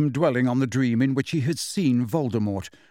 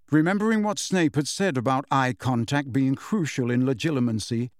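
plain reading voice

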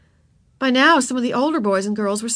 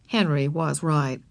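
plain reading voice

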